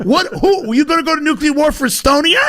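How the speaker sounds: fast, high mocking questions; escalating comedy rant. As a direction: needle them with mock questions.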